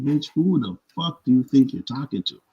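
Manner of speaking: angry comeback. Retold or imitated.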